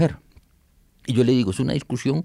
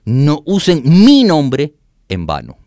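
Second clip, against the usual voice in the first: slow, much louder, higher "No usen mi nombre en vano"; emphatic quoted verse; 4 semitones higher, 9 dB louder, much slower than usual. Shown as a demonstration. Showing listeners the modern translation, slow loud proclamation. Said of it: "fíjese cómo dice la traducción".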